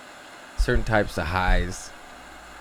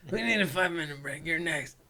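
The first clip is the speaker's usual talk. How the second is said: high, quiet voice between coughs; strained after dab; 7 semitones higher, 7 dB quieter than usual. Real, not acted. Choking after a hot dab.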